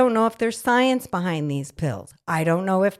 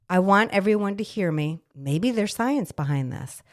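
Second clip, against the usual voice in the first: ordinary delivery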